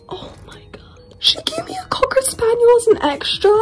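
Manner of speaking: breathless high whisper of disbelief; stunned whisper. Real, not acted. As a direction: whisper it, amazed.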